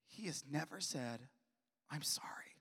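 soft, hushed retelling of a hurt mother; understated hurt, falling almost to a whisper; quietly tearful. Retold or imitated. Mother confiding what she never heard, quietly hurt. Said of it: "with tears in her eyes".

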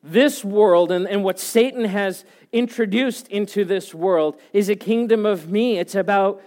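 loud, rising preacher's build; full-force pulpit preaching, voice pushed high. Acted. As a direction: preach it to the whole room with fire.

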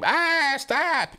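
high-pitched fake scream of stop; mock scream. Shown as a demonstration. Demoing the fake victim's scream, hammed-up high yelp. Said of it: "All you gotta do is just scream".

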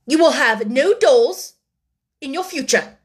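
stern scolding. A narrator's reading. Headmistress forbids the orphan her dolls, sternly. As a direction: lay down the law firmly.

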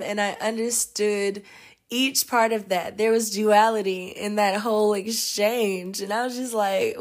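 ordinary talk

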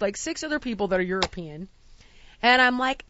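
ordinary talk, nothing marked

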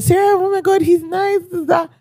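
high-pitched mock gushing; excited. Client gushing to planner about a man, excited. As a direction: imitate an excited client, softening as it trails away. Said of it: "my client's so excited".

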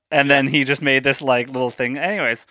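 usual speaking voice

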